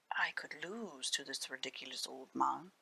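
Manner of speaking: low, soft thought said to himself; secret thought. Acted. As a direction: let it slip out under the breath, scheming.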